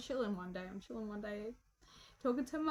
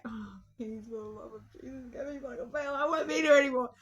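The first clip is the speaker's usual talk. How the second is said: hammed-up begging, rising to a loud finish; pleading; 6 dB louder than usual. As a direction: beg under your breath, then burst out. Pleading for bail in story.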